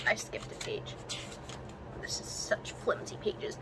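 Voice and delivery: breathless whisper to herself; whispered aside. Real, not acted. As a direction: drop to a whisper, just for yourself. Muttered aside while reading.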